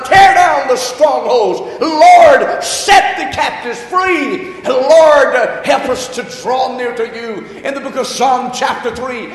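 loud, ringing preacher's cry, building; preaching at full force. Acted. Pastor rousing the congregation, loud preaching. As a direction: proclaim it to the whole church. Opening prayer and Scripture exaltation.

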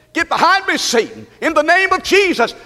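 high, ringing command; forceful rebuke. Shown as a demonstration. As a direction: cast him out with preacher's authority. Third, forceful take of rebuke.

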